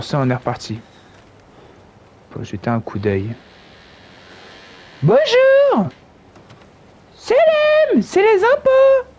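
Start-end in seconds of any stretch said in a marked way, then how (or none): 5.0-9.1 much higher falsetto, calling through the door; sing-song fake visitor announcing himself; high put-on caller voice.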